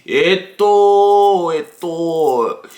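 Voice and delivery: slow, drawn-out Japanese in a put-on voice; mock a classmate labouring over his Japanese; show-off classmate. Imitating Tyrone answering in Japanese class, slow put-on show-off.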